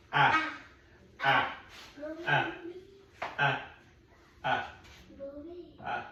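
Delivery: put-on "Ah" as a short a vowel, repeated for a child; short a sound. Shown as a demonstration. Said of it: "what's the second sound?".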